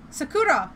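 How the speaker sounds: Sakura said the Australian way, sa-KOO-da; Aussie pronunciation of Sakura, flat and broad; Australian accent. Shown as a demonstration. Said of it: "I'm saying it in a wonderfully Australian accent".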